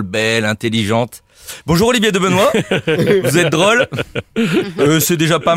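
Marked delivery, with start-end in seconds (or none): 1.6-3.9 same greeting, higher and laughing. Shown as a demonstration. Columnist greeting the guest, laughing at his own joke. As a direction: smirk through the flattering greeting. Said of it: "j'ai commencé".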